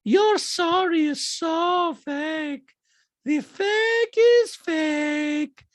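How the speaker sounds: loud, very high accusation, heavily drawn out; Diana. Retold or imitated. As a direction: accuse in a slow, squealing mock falsetto. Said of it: "Diana goes".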